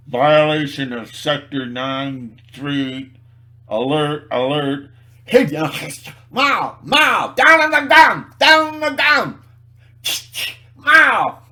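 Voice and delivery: loud alarm turning into high frantic shouting; mock enforcement raid. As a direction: play an over-the-top squad barking orders. Acts out expected enforcement raid.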